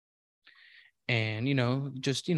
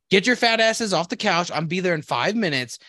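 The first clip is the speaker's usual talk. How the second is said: barked order; 5 semitones higher than usual. Retold or imitated. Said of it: "And then he".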